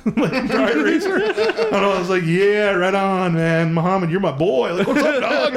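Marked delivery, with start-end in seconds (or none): 2.3-5.5 drawn-out, hyped-up buddy talk; chummy greeting. Retold or imitated. Driver greeting his new store buddies, overly chummy. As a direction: greet him like your best pal, hammed-up.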